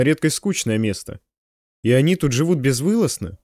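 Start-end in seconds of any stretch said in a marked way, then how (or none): none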